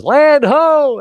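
high, loud shout of relief; screaming. Retold or imitated. Crewman spotting the harbour, high loud joyful yell. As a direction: yell it out at first sight of shore. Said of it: "someone screams".

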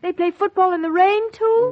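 aghast that they even play in the rain; surprised. Acted. Shocked at football in rain.

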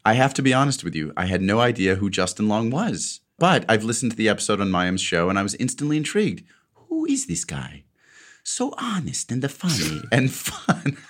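put-on a touch of Italian accent. Reading Sonia's letter aloud, a light Italian accent. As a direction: read the fan's words lightly in her Italian accent. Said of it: "try to say it like Sonya".

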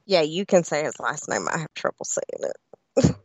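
ordinary talk, nothing marked

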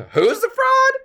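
very high, loud, mock-sweet farewell; sugary high kiss-off; mock Meredith. Imitating Meredith brushing off Jen, then leaving. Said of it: "Meredith says".

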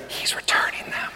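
soft, breath-only stage whisper. Retold or imitated. Secretary telling the doctor about the return, whispering. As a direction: leak the awkward news in a whisper.

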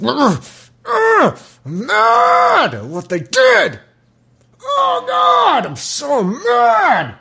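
high, strained cries of rage, slowed down; hammed-up furious old man; huffing angry old God. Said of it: "like this old man, just yelling at everybody".

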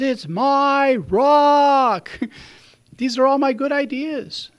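loud, high, slow shout, clinging to it; stubborn shout. Retold or imitated. Drowning man shouting back at rescuers, stubborn loud refusal. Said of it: "he says".

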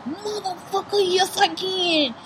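high, loud, drawn-out outburst; hammed-up indignant yell; acted defiant retort. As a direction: fire back in mock outrage, stretching the last words. Acted angry retort to being told no.

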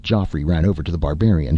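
ordinary delivery, nothing marked

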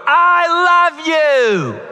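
high, drawn-out shout of love; father and son shouting love back and forth, slow and loud. Retold or imitated. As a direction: belt it out slowly like calling across the house. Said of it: "him and I are shouting back and forth".

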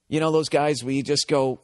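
normal talking voice

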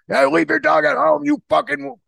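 high mock outrage at dog owners; mock angry Joey rant. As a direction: rant like a disgusted restaurant patron. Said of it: "Joey's like".

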